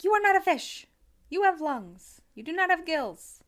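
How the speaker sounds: firm scolding parent voice; stern parent. Retold or imitated. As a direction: scold the kid, loud and firm. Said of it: "I'm like".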